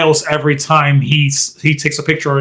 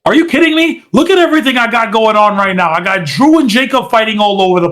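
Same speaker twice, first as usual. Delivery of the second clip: much higher, exasperated mock rant; 6 semitones higher, faster than usual. Nick Aldis turning Cardona down, exasperated and higher. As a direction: throw up your hands and rant. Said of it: "Nick Aldis is like".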